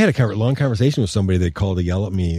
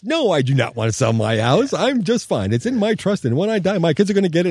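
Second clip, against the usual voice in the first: high, indignant mock old lady voice; indignant old woman; 9 semitones higher than usual. Woman listed as deceased scolds the investor, high and indignant. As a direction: play the outraged lady who is very much alive. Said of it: "She goes".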